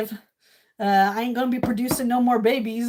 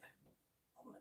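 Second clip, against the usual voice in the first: unvoiced hushed aside; whisper; 34 dB quieter, faster than usual. Real, not acted. Streamer whispering while placing a map pin. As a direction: whisper it to yourself.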